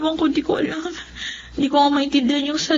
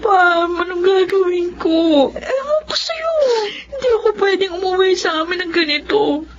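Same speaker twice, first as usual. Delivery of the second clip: crying out in despair; 7 semitones higher than usual. Acted. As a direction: wail it through tears, panicking. Emmy learns she's pregnant.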